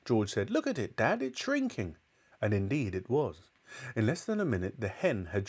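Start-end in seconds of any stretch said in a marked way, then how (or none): 0.5-2.0 put-on very high, amazed boyish voice; excited boy. A narrator's reading. George telling Dad about the hen, high amazement. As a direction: draw attention in a high excited voice.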